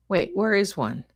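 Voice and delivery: normal talking voice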